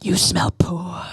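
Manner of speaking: slow hushed sneer in someone's ear; mock snobby whisper. Snob at wine tasting whispers insult, slow and hushed. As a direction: lean in and whisper the insult slowly. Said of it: "lean in and go".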